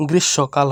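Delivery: গ্রীষ্মকাল said "grish-sho-kal"; silent M. Shown as a demonstration. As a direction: leave out the ম in গ্রীষ্মকাল. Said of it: "ময়ের উচ্চারণটা হবে না".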